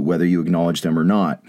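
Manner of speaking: ordinary delivery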